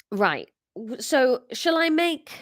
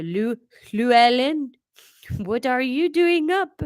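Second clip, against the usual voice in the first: put-on French accent, louder; 3 semitones higher, 4 dB louder than usual. Parent asks the younger son, French accent. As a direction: scold lightly in a French accent.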